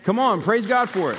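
high, exhorting call to a crowd; rally them with a lifted voice; rousing call. Real, not acted. Preacher urges the congregation to praise God, rousing.

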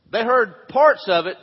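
loud and slow, pressing each word; emphatic slow stress. Real, not acted. Preacher to his congregation, slow loud emphasis. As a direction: drive the point home to the room.

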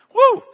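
loud, high joyful whoop; excited whoop. Real, not acted. Preacher whoops at the good news, real excitement. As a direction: let out a thrilled whoop.

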